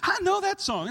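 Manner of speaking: light up at the familiar song; excited recognition. Retold or imitated. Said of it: "And she".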